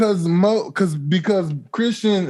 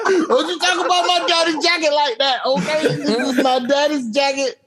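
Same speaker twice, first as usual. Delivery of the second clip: mock offended; 8 semitones higher than usual. Mock offended over daddy's jacket.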